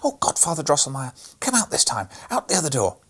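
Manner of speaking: called out. A narrator's reading. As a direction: call out eagerly like a boy. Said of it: "Fred called out patiently".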